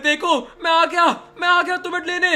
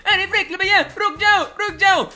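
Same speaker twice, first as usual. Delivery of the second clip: put-on raised child voice calling him back; pleading kid; 6 semitones higher than usual. Kid begging big brother not to leave, pleading call. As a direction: beg him to stay.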